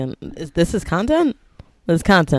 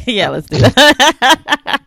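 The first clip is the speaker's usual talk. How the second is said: laughing; 8 semitones higher, 11 dB louder, much slower than usual. Pretend. Laughing mock intro.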